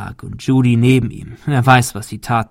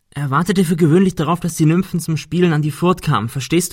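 higher, quick, nervously chatty; Ben telling the myth; 6 semitones higher than usual. Acted. Ben talking to injured Cecil, anxious storyteller voice. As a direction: rattle it off to keep Cecil awake. Ben's character voice, retelling Pan.

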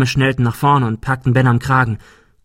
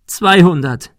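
high, louder distressed plea; tearful; 7 semitones higher, 6 dB louder, slower than usual. A narrator's reading. Girl raises her offer to the bully, tearful. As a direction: offer it as if crying. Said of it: "weinte sie".

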